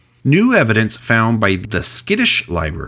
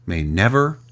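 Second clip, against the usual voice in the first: usual speaking voice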